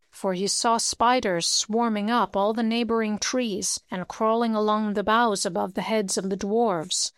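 plain reading voice

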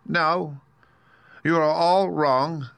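high, slow correction; meekly drawn-out admission. Acted. Little man correcting his visitors, meekly. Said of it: "said the little man meekly".

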